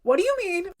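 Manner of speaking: stunned disbelief; startled question. Retold or imitated. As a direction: jump in shocked: what do you mean. Girls startled by the Lucifer claim.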